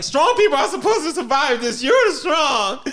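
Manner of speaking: high, loud, laughing outburst; mock wailing yell. Grieving mom berating her dead son, hammed-up yell.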